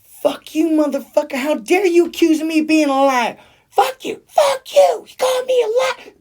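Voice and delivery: high, loud screaming rage; screaming furious woman. Retold or imitated. Retelling a woman screaming at a man on a plane, furious shriek. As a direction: shriek it like she's losing it, near tears. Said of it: "she says".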